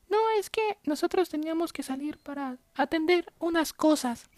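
put-on high, nagging old lady voice; whiny lady voice. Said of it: "ellas me responden".

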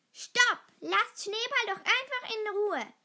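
high, loud protest; angry shout. A narrator's reading. Nala orders the bullies to leave Schneeball alone, angry. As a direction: burst in to defend a friend. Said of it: "Nala lief wütend zu ihnen".

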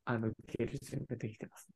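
whisper. Pretend. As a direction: breathe it conspiratorially. Joking readiness, whispered aside.

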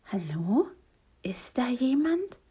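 tentative, drawn-out question, rising; wary, questioning hello; cautious call. A narrator's reading. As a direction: call carefully into the unknown. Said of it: "fragt er vorsichtig".

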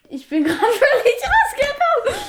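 high laughing giggle. Real, not acted. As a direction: giggle through the words. Laughing after losing the thread.

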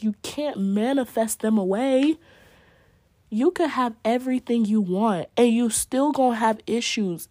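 ordinary delivery

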